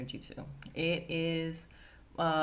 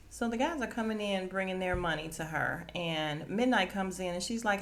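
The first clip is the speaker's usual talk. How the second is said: ordinary delivery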